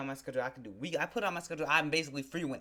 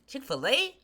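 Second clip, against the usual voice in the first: loud, high rising question; offended, pitch shooting up at the end; incredulous; 12 semitones higher, 7 dB louder, slower than usual. Real, not acted. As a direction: throw the name out in disbelief. Incredulous at who rejected him.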